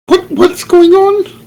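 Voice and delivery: high, startled, drawn-out question; sudden alarm in character. Acted.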